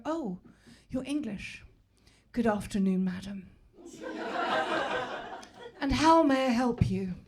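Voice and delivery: mock-formal royal English accent. Shop worker answering an English customer, very English accent. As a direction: put on exaggerated King's English, pausing between phrases. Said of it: "He sounded more English than the king".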